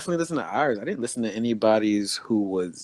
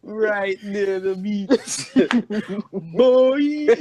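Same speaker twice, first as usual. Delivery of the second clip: higher, very slow West Indian lilt broken by laughs; mocking West Indian accent; 11 semitones higher, 6 dB louder, much slower than usual. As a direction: tease a friend by parroting his accent through laughter. Mocking a friend's accent line.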